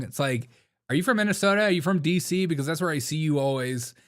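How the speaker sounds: usual speaking voice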